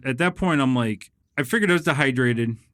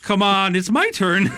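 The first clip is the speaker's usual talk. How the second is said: high, louder whiny complaint; mock impatient patient; 8 semitones higher, 4 dB louder, slower than usual. Waiting patient to hospital staff, mock impatience. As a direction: push to be seen next, hammed up.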